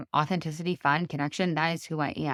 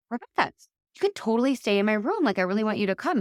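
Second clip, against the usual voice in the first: bright, eager invitation, higher; eager invite; 6 semitones higher, 3 dB louder, faster than usual. Retold or imitated. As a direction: gush the offer at a friend.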